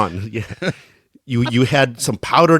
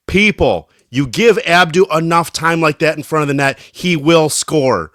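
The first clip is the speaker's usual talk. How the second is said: louder, higher mock announcement, slowing at the end; imitating Ponton; 6 semitones higher, 8 dB louder than usual. Player quoting teammate praising his goal, hammed-up loud proclamation. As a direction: ham up a teammate proclaiming it to everyone. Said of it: "and Ponton goes".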